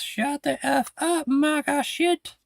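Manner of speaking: high-pitched, drawn-out mock shriek; mock scream. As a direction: imitate a screaming protester, slow and very high. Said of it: "screams at ice".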